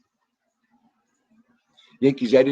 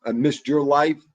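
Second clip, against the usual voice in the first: ordinary delivery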